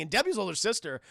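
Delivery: ordinary delivery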